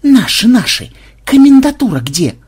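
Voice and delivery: high, loud demand; impatient shouting. A narrator's reading. Impatient shouted question to granny.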